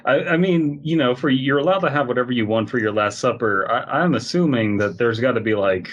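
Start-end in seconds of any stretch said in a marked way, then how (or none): none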